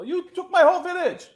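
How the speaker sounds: high, loud outraged villager; mock yell. Angry villager confronting Shaggy, high mock yell. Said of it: "they tried to yell at him".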